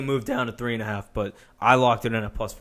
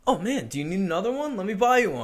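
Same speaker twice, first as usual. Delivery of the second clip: high-pitched, overly obliging local; friendly Minnesotan; 7 semitones higher, faster than usual. Acted. Bar-goer to drink spiller, friendly Minnesotan. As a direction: imitate an eager, polite bar-goer. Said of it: "spill a drink on someone and be like".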